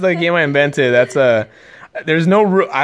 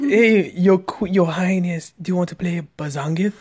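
mock royal servant; 3 semitones higher than usual. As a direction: lightly play an old-time servant. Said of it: "'Cause back then".

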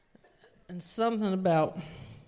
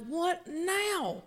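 higher-pitched exasperated question; mock weary irritation, pitched up; 8 semitones higher, 4 dB quieter than usual. Comedian reacting to a restless crowd, exasperated.

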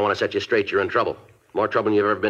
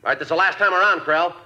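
loud, high call through a door; 9 semitones higher, 3 dB louder than usual. Acted. Detective calls through the barricaded door to the suspect, loud and high. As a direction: project it to the man behind the door.

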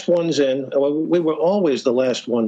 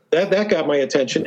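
normal talking voice

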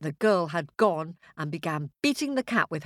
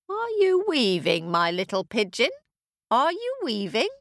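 high, singsong call that swoops on "weaving"; witch calling sweetly; 6 semitones higher than usual. Acted. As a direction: call out to check on the girl. Witch calls out to weaving girl.

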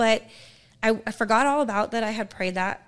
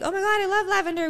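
high, quick gush; delighted retold exclamation; 10 semitones higher, 3 dB louder, faster than usual. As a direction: gush about it, pitched up and fast. Retelling her delighted reply.

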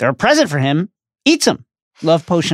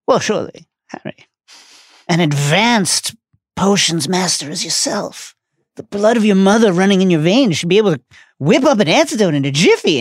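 put-on voice of a pompous professor, higher-pitched; Slughorn; 6 semitones higher than usual. A narrator's reading. Professor addressing his star potions student, grandly flattering. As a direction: flatter with theatrical, halting grandness.

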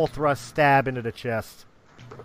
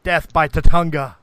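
loud movie quote; 3 semitones higher, 10 dB louder than usual. Retold or imitated.

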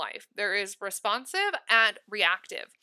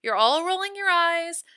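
rising high and louder, playfully teasing; playful exaggeration; 8 semitones higher, 5 dB louder than usual. Real, not acted. To viewers groaning at cold showers, playful teasing lift. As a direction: tease the eye-rollers with a big lift.

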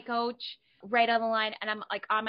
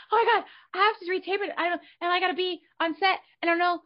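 high, fast, breathless rush of worry; mock panic; 10 semitones higher, 5 dB louder, faster than usual. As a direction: gabble it out to the manager in a panic. Panicked call to her manager.

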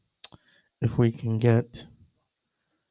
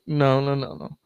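ordinary delivery

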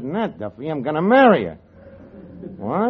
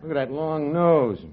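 ordinary talk, nothing marked

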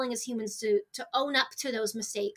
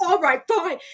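mock giving in; 4 semitones higher, 7 dB louder, slower than usual. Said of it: "And I was like".

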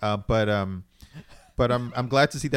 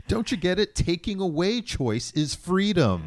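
sarcastic mock argument; 11 semitones higher, 5 dB louder than usual. Mocking the anti-choice argument.

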